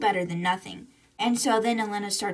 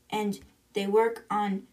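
ordinary talk

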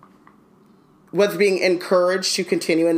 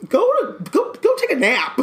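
high, laughing dismissal; amused parent; 10 semitones higher than usual. Retold or imitated. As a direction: shoo the kid off to bed, chuckling. Parent voiced, laughing at her.

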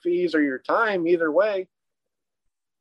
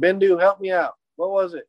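usual speaking voice

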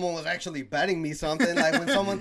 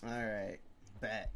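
low, soft, offhand agreement; slow, subdued retold concession; casual acceptance; 7 semitones lower, 11 dB quieter, slower than usual. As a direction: act out quietly going along with it. Acting out catching on to slang.

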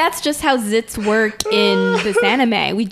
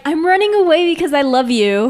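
higher-pitched melodramatic quote; hammed-up parting cry of love; mock declaration of love; 6 semitones higher, 4 dB louder than usual. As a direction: play a lover's farewell, lightly mocking. Acting out Horse's declaration to the girl.

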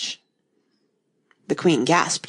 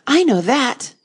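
high, loud eager cry; 9 semitones higher, 5 dB louder than usual. A narrator's reading. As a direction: blurt it out eagerly. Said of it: "Alice cried eagerly".

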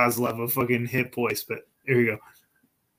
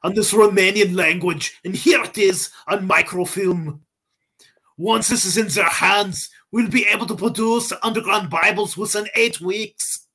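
higher-pitched mock Austrian accent; botched Russian turned Austrian; Austrian-sounding accent; 6 semitones higher than usual. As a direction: keep up the hammed-up Germanic accent. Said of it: "Now it's Austrian".

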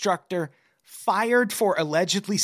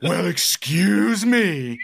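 slow, drawn-out huffy retort; mock indignation; 4 semitones higher, 4 dB louder, much slower than usual. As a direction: play offended, stretching the words. Mock indignation at being imitated.

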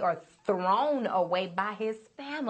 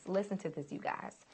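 hushed aside; 7 dB quieter than usual. Real, not acted. Preacher hushes congregation.

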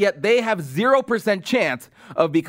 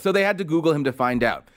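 usual speaking voice

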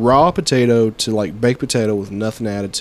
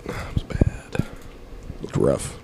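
whisper; 10 semitones lower, 10 dB quieter, much slower than usual. Real, not acted. Reacting to deadly earthquake.

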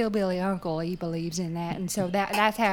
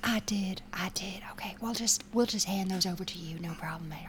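near-whisper, much softer; hushed confiding; 9 dB quieter than usual. Pretend. Server secretly promises the star his goat feet, whispering. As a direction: whisper it so the others won't hear.

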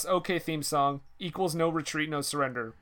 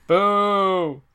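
mock boo; 4 semitones higher, 8 dB louder than usual. Mock booing at cut scenes.

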